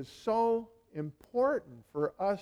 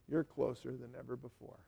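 softer repeat, hushed and intimate; quiet; 6 semitones lower, 14 dB quieter than usual. Real, not acted. Pastor reassuring reconciled believers, softly. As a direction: let it sink in gently. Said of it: "I wanna tell you something".

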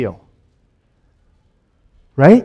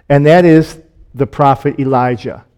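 ordinary delivery

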